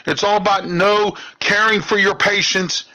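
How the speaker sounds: usual speaking voice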